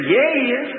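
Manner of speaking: imitated high-pitched call from the crowd. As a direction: pipe up high to give someone away. Neighbor calls out, pointing him out.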